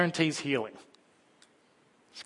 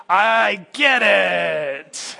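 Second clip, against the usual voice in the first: slow, louder knowing cry; sympathetic shout; 5 semitones higher, 7 dB louder, much slower than usual. Retold or imitated. Parent shouting along with the king, slow and loud. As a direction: call out in weary agreement. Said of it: "I'm going".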